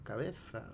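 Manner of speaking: "cabeza" with a lisped z, like Spain's theta; theta pronunciation. Shown as a demonstration. Profe corrige el seseo a los alumnos con ceceo marcado. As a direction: say "cabeza" with the tongue between the teeth on z. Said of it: "Lo ideal sería".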